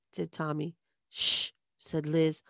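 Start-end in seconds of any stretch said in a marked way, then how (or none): 1.1-1.5 sharp, loud "shh"; loud shushing. A narrator's reading. Liz hushing the boy in the horse costume, loud shush. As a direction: hush a child who talks too much. Liz hushes her little brother.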